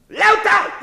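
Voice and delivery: high, loud barked order; harsh command. Acted. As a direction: bark it at him.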